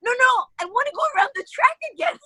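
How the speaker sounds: high, fast, eager begging; excited pleading. Retold or imitated. Actress begging the director to go around the track again, high and fast. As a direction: beg like a thrilled kid for another ride.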